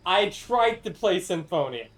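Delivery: tried leaned on with a falling tone; stress on tried. Shown as a demonstration. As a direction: hit the word tried hard. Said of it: "Emphasis on tried".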